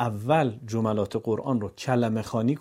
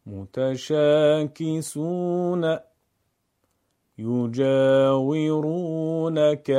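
slow, louder chanted words; Quran recitation; 2 semitones higher, 4 dB louder, much slower than usual. Shown as a demonstration. Teacher reads practice words joined. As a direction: draw each long vowel. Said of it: "پیوسته خوانی".

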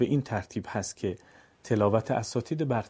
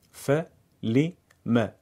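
"فلم" split very slowly into syllables; 4 dB louder, much slower than usual. Shown as a demonstration. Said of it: "هجا هجا بخونید".

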